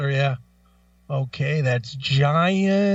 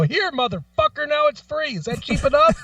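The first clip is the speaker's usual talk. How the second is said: much higher mock tirade, loudest at the end; mock angry tirade; 10 semitones higher than usual. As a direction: play at hurling insults at the cheap man. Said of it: "and go".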